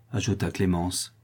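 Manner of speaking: ordinary delivery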